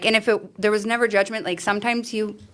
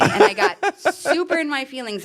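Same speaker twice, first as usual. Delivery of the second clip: laughing; 9 semitones higher than usual. Real, not acted. Laughing about getting upset.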